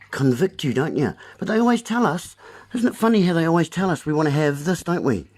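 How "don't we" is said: ordinary delivery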